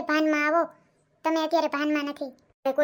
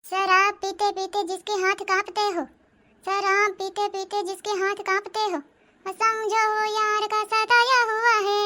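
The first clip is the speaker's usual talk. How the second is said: higher, slow dramatic couplet; filmy shayari recital; 6 semitones higher, slower than usual. Acted. Drunk son reciting a sad Hindi couplet, hammed-up. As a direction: declaim it like a tragic film poet.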